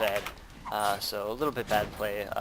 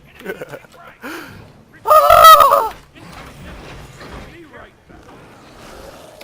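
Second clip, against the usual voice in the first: long high laughter, fading down; long loud laugh; 19 dB louder than usual. Real, not acted. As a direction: laugh hard at your own joke. Laughing after taunt.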